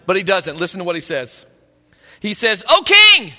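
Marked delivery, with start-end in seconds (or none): 2.7-3.3 loud, raised call up to the king. Acted. Daniel calling up from the lions' den, loud. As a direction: call up from the pit to be heard.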